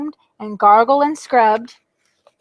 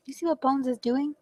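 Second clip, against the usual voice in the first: ordinary delivery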